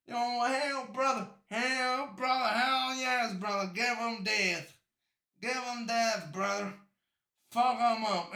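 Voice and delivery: loud victory hollering; hyped cheering. Real, not acted. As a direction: cheer like your team just won. Celebrating the death sentence.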